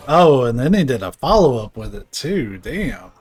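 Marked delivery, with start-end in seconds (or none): none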